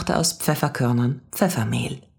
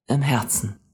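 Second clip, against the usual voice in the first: even, reassuring reply; calm confirmation. A narrator's reading. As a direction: confirm it gently. Man gently confirms.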